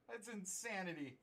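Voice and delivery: ordinary delivery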